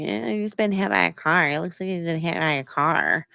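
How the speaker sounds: put-on parroting voice; sneering imitation of someone else's words; mocking, mimicking. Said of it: "in, like, a mocking, mimicking way".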